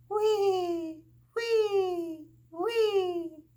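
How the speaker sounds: high, loud whistling snore; snoring sound. A narrator's reading. Narrator voices Big Mouse snoring in bed, high loud whistle. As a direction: squeak out the snore. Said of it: "you're snoring".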